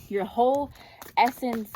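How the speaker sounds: normal talking voice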